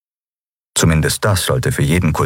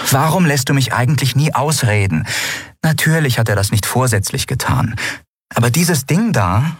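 lightly voiced young woman, higher and mocking; Lien, amused and scoffing; 7 semitones higher than usual. Lien mocks the crew's fear of the cult, amused and higher. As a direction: tease them for their fear, amused.

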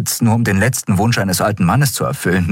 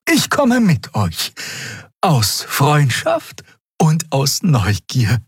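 put-on slow, high old woman's voice; old wise Xia; 9 semitones higher, slower than usual. Old Xia telling the crew her decision, slow aged voice. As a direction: take your time like an elder.

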